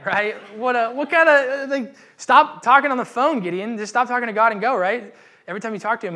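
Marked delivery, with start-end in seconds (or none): 0.6-4.8 much louder, higher, mock-exasperated rant; exasperated outburst. Real, not acted. Preacher scolding Gideon for talking to God, exasperated shout. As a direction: throw up your hands at Gideon.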